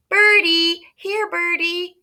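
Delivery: put-on very high little-kid voice, slow coaxing call; toddler calling birds. Fudge calling the pigeons, high toddler coax. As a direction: coo for the pigeons like a small child. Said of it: "he called".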